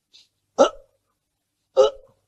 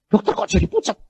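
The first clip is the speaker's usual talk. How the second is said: higher, startled nurse; nurse alarmed; 7 semitones higher, 4 dB louder than usual. Retold or imitated. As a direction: ask in sudden alarm. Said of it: "Suster saya bilang".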